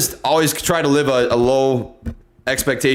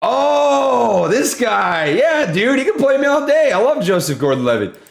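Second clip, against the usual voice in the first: excited; 9 semitones higher, slower than usual. Real, not acted. Streamer sees the actor's photo, excited high gush.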